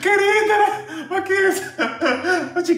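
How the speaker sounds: high squealing laughter; laughing. Real, not acted. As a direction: laugh helplessly at the joke. Laughing at her greeting.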